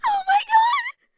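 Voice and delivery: very high, loud shriek; squealing in sheer panic; panicked interruption. A narrator's reading. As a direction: burst in with a drawn-out panicked squeal. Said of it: "not hiding the panic in her voice".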